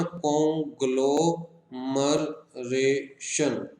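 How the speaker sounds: कॉन्ग्लोमरेशन very slowly as कॉन, ग्लो, मर, रे, शन; five-syllable split. Shown as a demonstration. Teacher counting five sounds, कॉन्ग्लोमरेशन in separate slow beats. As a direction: pause after each syllable of कॉन्ग्लोमरेशन. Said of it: "अलग अलग sound आ रही है".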